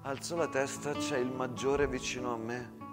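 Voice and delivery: ordinary talk, nothing marked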